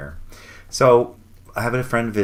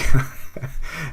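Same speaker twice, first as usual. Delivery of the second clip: short, loud, higher-pitched chuckle; delighted laugh; 7 semitones higher, 6 dB louder than usual. Real, not acted. Host amused by the parking coincidence, short laugh. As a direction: burst into a brief real laugh.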